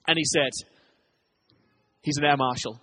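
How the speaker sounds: ordinary talk, nothing marked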